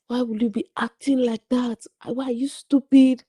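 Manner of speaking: high, strained mock scolding; angry father. Father shouting at daughter back home, imitated anger. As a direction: imitate a furious dad's indignant questions. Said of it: "he said that in anger".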